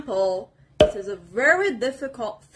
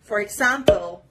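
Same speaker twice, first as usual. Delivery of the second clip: ordinary talk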